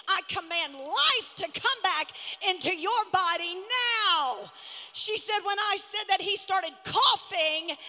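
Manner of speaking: loud, rising preacher's shout, building to a cry; preaching at full force. Acted.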